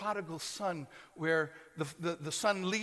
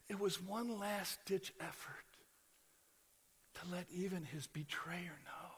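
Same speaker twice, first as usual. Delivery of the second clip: soft, breathy near-whisper; hushed whisper; 2 semitones lower, 11 dB quieter than usual. Real, not acted. Preacher whispering Jesus's love for Judas. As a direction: draw them in with a hush. Sermon climax, hushed intimate voice.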